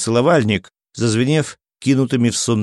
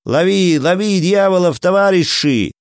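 higher, stretched rallying cry; raised, urgent narrated yell; soldier's yell; 7 semitones higher than usual. A narrator's reading. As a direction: call comrades to the chase. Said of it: "заорал дотошный солдат".

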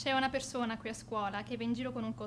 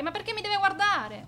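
higher and louder, slowed indignant protest; indignant outburst; 8 semitones higher, 6 dB louder, slower than usual. Acted. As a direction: demand it, outraged. Annoyed girl defends brother.

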